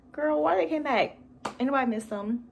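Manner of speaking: incredulous. Real, not acted. Incredulous at characters returning.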